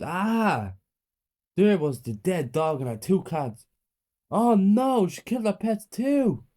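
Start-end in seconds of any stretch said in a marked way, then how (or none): 0.0-0.7 short frightened scream. A narrator's reading. Kids screaming in shock at the table, frightened cry. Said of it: "and screamed". 4.3-6.5 high, loud horrified cry; horror. A narrator's reading. Child discovering dead pets, horrified cry. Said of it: "I said in horror".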